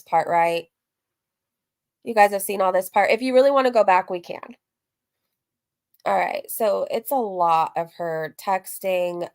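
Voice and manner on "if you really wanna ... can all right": ordinary delivery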